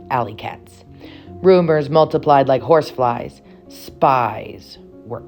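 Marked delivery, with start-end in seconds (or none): none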